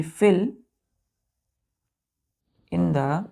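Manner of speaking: slow phrases with long pauses; word-by-word dictation. Shown as a demonstration. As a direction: dictate it for pupils to copy. Dictating example sentence word by word.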